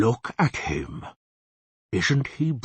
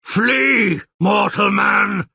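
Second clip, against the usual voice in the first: huge, high roared threat with drawn-out words; roaring monster, much louder and higher; 11 semitones higher, 8 dB louder, much slower than usual. Acted. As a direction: bellow it like a monster scaring off an intruder. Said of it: "the monster roared".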